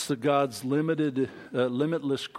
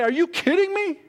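high-pitched, loud disbelief; squeaky, scandalised astonishment; incredulous; 14 semitones higher, 4 dB louder than usual. Acted. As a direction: fire off each question in mock outrage. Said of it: "today we would say".

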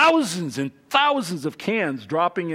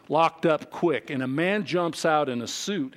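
normal talking voice

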